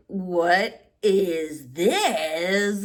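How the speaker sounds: slow, drawn-out, half-crying disbelief; stunned disbelief. Retold or imitated. Reliving the shock of turning thirty-four, drawn-out disbelief. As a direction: let the words stretch out in shock.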